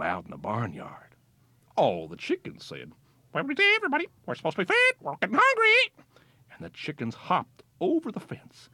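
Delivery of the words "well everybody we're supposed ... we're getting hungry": very high squeaky hen talk; high chicken voices. A narrator's reading. Chickens in the barnyard asking to be fed, very high. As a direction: cluck it out like hungry hens.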